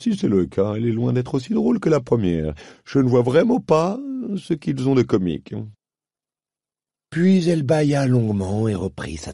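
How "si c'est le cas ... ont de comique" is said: lightly voiced, unimpressed panther; lazy panther. A narrator's reading. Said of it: "une panthère qui était en train de se nettoyer". (panthère blasée, voix légèrement changée)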